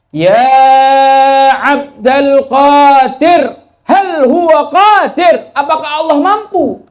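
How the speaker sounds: put-on loud, high, slow slurred call of a drunk; drunkard calling out. A drunkard calls out to Sheikh Abdul Qadir, loud, high and slow. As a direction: drag the words out like a drunk stopping a sheikh in the street. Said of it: "Tanya lagi".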